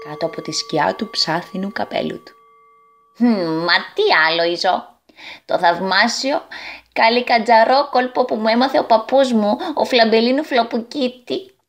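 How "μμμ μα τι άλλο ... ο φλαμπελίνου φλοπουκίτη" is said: sly goblin. Acted. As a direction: boast of a family trick with a sly hum. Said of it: "χαμογέλασε πονηρά". (πονηρός καλικάτζαρος)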